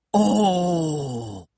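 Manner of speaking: long, falling "oooh"; drawn-out gasp of mild shock; shocked, intrigued "oh". A narrator's reading. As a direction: react as a scandalised yet curious audience. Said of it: "à la fois choquées et intriguées".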